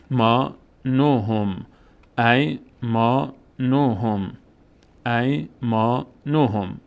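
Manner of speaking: ای ما نوهم slow, long aa in ما; slow recitation. Shown as a demonstration. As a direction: recite ای ما نوهم gently and drawn out. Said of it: "ساکنش افتاده".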